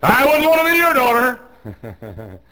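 high, loud, drawn-out mimicry; mocking objector. Preacher mimics someone objecting to his rules, mock cry.

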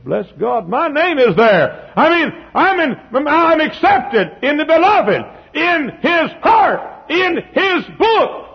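high, loud, slow pulpit shout; preacher crying out each phrase slowly; full-force preaching. Real, not acted. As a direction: shout it out in triumph.

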